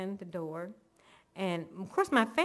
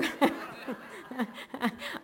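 airy laugh; 5 semitones higher, 4 dB quieter than usual. Real, not acted. Laughing at God's humor.

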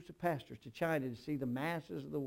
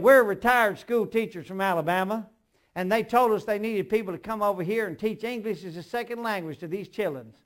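put-on Alabama accent; 6 semitones higher, 12 dB louder than usual. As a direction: play up the retired teacher's Alabama accent. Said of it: "now watch this".

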